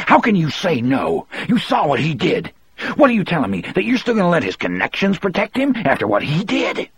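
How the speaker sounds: high, sharp outburst of fury; furious. Acted. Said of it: "he asked me furiously".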